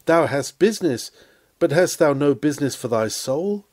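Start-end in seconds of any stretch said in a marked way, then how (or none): none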